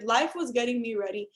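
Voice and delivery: usual speaking voice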